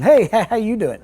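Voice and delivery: P=A; cheery greeting. Pretend. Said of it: "and then going".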